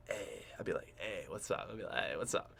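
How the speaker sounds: soft, low, near-whispered hey; hushed greeting. Retold or imitated. Nodding to the Russian guy from afar, a hushed low greeting. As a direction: barely voice a casual hello. Greeting him from far away.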